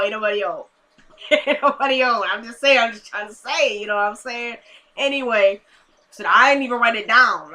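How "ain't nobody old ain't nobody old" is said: mock old-folk voice, played for laughs; teasing that nobody is old, silly voice; silly put-on voice. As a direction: say it in a goofy voice with a laugh. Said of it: "I'm just playing".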